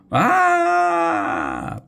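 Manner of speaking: very high scream of fright; terrified scream. A narrator's reading. Chauncey fleeing the giant, high terrified scream. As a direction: shriek in sudden panic. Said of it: "screamed Chauncey".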